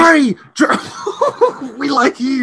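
high, loud laughing yell; laughing shout. Real, not acted. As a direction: shout his name through laughter. Teasing shout at friend.

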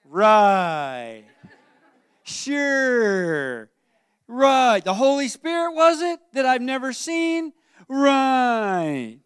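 loud, drawn-out sarcastic "right"; mock-incredulous Joseph; sarcastic disbelief. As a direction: scoff at the story like a doubting fiancé. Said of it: "think about Joseph as saying".

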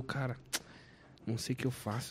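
low, soft hopeless mutter; quiet retold despair. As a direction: mumble it, defeated.

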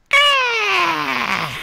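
long, sky-high screech; very high mock wail, sliding down at the end; Rita. Shown as a demonstration. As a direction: shriek like a cartoon villainess. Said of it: "It just takes one thing to do Rita".

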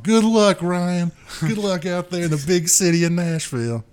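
high, loud, hammed-up well-wisher yelling goodbye; cheering send-off. Hometown friends shouting goodbye to the new drummer, hammed-up cheer. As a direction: shout good luck like an overexcited hometown crowd. Townsfolk seeing him off.

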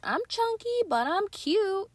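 put-on very high, slow, squeaky toddler voice; little kid voice. Her baby self boasting about being cute, squeaky. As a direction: sing it like a proud chubby toddler. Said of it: "I was like".

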